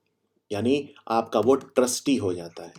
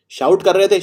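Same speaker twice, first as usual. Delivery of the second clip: mock shout; 7 semitones higher, 6 dB louder than usual. Shown as a demonstration. Explaining shouting, voice raised.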